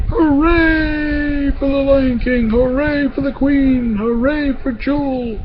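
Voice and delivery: high, drawn-out imitated cheers; crowd cheering. A narrator's reading. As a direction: cheer like a whole crowd, without shouting. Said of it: "everybody started cheering".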